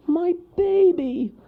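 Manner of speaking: very high, loud, slowed mother's scream. Acted. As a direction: cry out for her baby. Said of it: "screamed the mother squirrel".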